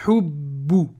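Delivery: hubbu with the b pressed hard and doubled; stressed doubled b. Shown as a demonstration. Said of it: "on va bien appuyer le bou".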